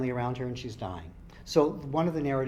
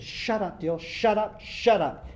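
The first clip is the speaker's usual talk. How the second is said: higher and louder, repeated; shouted shut up; 8 semitones higher, 6 dB louder, slower than usual. Acted. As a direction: shout him down. Claire silencing Dylan.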